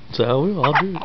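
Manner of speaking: ordinary talk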